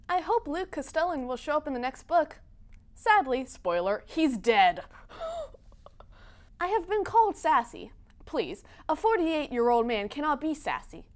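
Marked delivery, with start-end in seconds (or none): 5.1-7.9 higher, gasping in mock offense. Author pretending shock at being called sassy, mock offended. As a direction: clutch pearls, gasp.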